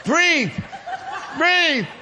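high, loud one-word commands; hammed-up coach losing control; panicked coaching shout. Husband hyperventilating while holding the bed, panicked coaching shout.

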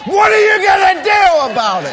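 all-out high preacher's holler; defiant roar. Acted. Believer challenging the devil at the climax, defiant roar.

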